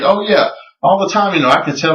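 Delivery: high-pitched quoted agreement; imitated ready agreement. Creationist agreeing he slows down when speeding, imitated agreement. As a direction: agree readily, pitch jumping up.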